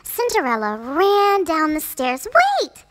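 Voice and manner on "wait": very high, loud call. A narrator's reading. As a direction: call out to stop them. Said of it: "she called".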